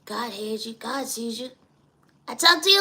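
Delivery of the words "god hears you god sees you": ordinary talk, nothing marked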